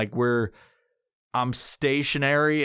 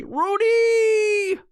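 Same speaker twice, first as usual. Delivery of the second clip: loud high call across the house; 5 dB louder than usual. Real, not acted. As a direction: yell the name out to someone far off. Calling out to someone.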